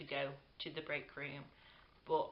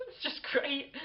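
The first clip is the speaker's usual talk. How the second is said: high, louder, drawn out after a laugh; amused delight; 10 semitones higher, 5 dB louder, slower than usual. Real, not acted. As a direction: gush through a laugh, pitched up. Laughs while praising the cafeteria.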